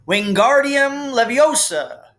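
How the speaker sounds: loud, slow, high shouted incantation; shouted spell. A narrator's reading. Ron shouting the spell while waving his arms. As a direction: bellow the spell at the feather. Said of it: "he shouted".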